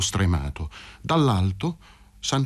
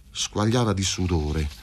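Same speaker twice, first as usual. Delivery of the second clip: plain reading voice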